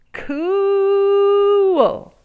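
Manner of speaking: high, loud, drawn-out admiring cool; big awed cool. A narrator's reading. Narrator marvels at the jumping bean, long awed cool. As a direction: swoon over the word cool.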